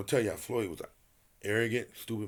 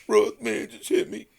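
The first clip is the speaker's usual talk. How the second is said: higher and louder, mocking a whiny plea; mocking Floyd; 12 semitones higher, 6 dB louder than usual. Retelling Floyd's late-night call, a higher, louder mocking whine. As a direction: mimic him crying for help on the phone. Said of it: "Yeah, that's how you talk".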